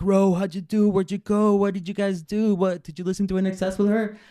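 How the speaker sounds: high, loud, eager questioning; overexcited classmates all asking at once; excited kids. Retold or imitated. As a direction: fire questions like thrilled schoolkids. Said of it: "all the kids were so excited".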